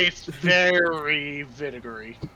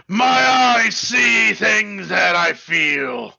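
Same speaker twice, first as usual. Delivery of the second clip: slow, loud crying shout; cursed wail; 6 semitones higher, 10 dB louder, much slower than usual. Acted. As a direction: wail it like a tortured madman.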